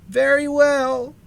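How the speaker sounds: ordinary delivery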